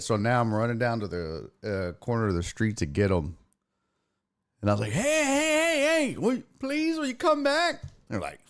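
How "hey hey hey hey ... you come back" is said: high, pleading shout after the truck; calling out. Retold or imitated. Man chasing the trash truck calls after the departing crew, pleading.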